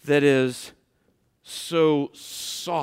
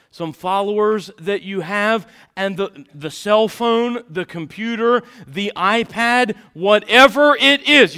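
building sermon fervor; 7 semitones higher, 11 dB louder than usual. Real, not acted. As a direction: list climbing louder and higher to a peak. Preaches a list with rising force.

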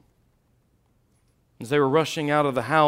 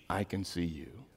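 soft reassurance; 5 semitones lower, 10 dB quieter than usual. Acted. Father's quiet last line.

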